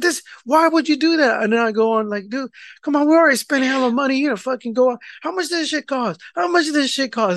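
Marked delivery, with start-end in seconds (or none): 0.0-1.4 high-pitched, indignant question; exasperated disbelief. Retold or imitated. Husband questioning wife about the shirts, exasperated. 2.3-7.4 high-pitched, exasperated griping; retold argument, pitch up but not shouting; hella mad. Husband arguing with wife over money spent on shirts, worked up. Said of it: "And I'm getting hella mad".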